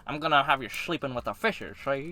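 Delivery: put-on old-time mafia tough-guy voice; sixties mobster. Teasing the cohost about the accent, as a sixties mobster. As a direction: slur it like a movie gangster. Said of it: "like a mobster, like mafia".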